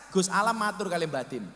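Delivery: normal talking voice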